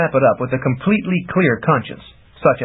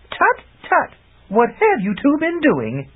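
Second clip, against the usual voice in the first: high sorceress voice, slow sly tut-tut; mock scolding; 12 semitones higher than usual. A narrator's reading. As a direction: tease them like a knowing witch. Sorceress mocking the lovers.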